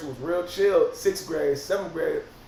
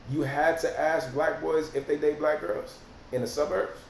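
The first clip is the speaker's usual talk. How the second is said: ordinary talk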